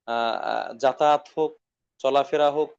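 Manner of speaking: normal talking voice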